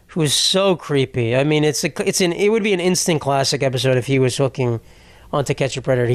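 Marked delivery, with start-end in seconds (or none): none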